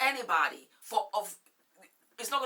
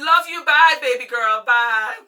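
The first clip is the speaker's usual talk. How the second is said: much louder, higher, affectionate farewell; loud fond goodbye; 4 semitones higher, 17 dB louder than usual. Real, not acted. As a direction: call out your love as you hang up. Saying goodbye to sister.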